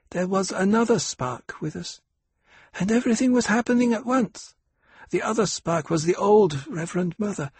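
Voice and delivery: lightly voiced little girl, high and slowed; half-lisping child. A narrator's reading. Aliyah telling her mother, lightly childlike and high. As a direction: tell it like a small child. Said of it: "half-lisping voice of her daughter".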